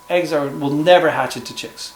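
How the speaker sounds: normal talking voice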